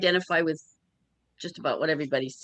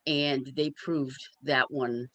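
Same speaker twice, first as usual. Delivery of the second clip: ordinary talk, nothing marked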